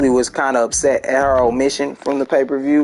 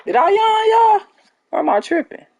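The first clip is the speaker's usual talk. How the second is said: very high-pitched, louder disbelieving question; incredulous; 4 dB louder than usual. Real, not acted. Streamer asking viewers about a yawn, incredulous. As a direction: squeal the question up high, baffled. Asks listeners if they heard yawning.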